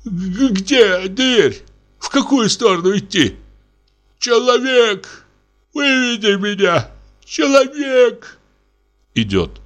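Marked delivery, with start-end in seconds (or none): 0.0-8.4 the confused captain calls a servant to lead him out; flustered. A narrator's reading. Flustered calling out. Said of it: "Растерян".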